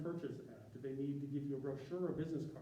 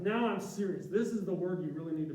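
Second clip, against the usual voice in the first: louder and higher, hammed-up insistence; mock over-emphasis; 4 semitones higher, 7 dB louder than usual. Mocking clients who bold everything, in a hammed-up loud voice. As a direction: play the author who thinks shouting makes it matter.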